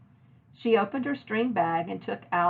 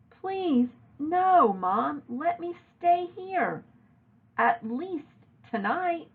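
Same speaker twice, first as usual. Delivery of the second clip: high, slow begging; begging mother, higher and slow; pleading; 9 semitones higher, slower than usual. A narrator's reading. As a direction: beg to stay the night. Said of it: "begging to stay".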